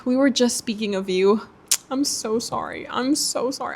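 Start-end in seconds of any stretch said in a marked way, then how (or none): 1.9-3.8 soft, cooing apology; tender mock apology. Shopper speaking to a book she can't buy, soft apology. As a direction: apologise softly, as if to a loved one.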